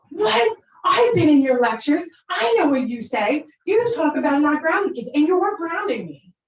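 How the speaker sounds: high, loud imitation of an indignant teenager; outraged protest. Daughter accusing lecturer mom of grounding her, acted outrage. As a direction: throw mom's own words back at her, loudly.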